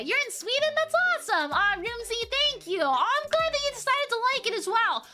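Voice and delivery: high-pitched, grateful gush; delighted gushing. Real, not acted. Streamer thanking Rumsey from Sweden, delighted gushing.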